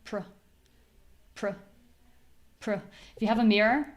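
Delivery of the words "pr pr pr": "Pr" as a short reduced pruh; tiny unstressed "Pr" with hardly any vowel; lips barely moving. Shown as a demonstration. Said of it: "don't really need to do very much here".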